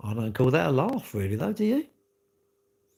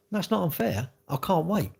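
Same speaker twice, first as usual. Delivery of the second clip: normal talking voice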